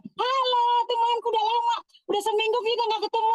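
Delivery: high, beaming hello to a church friend; overjoyed to see a friend at church; cheerful friendly greeting. Shown as a demonstration. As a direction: greet your friend with a big smile in your voice. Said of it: "Kalau ketemu orang jangan gini".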